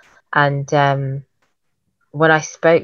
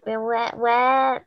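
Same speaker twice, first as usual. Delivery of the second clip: put-on timid girls; 6 semitones higher, much slower than usual.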